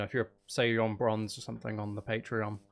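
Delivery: ordinary talk, nothing marked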